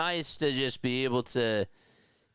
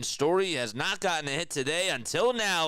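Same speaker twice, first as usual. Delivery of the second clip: excited play-by-play, much higher; play-by-play call; 8 semitones higher than usual. Acted. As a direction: call the hit like a broadcaster.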